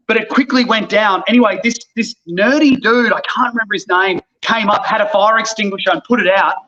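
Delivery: ordinary talk, nothing marked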